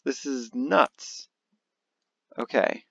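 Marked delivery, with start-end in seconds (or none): none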